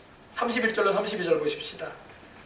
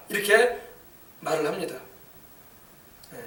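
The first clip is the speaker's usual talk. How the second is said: normal talking voice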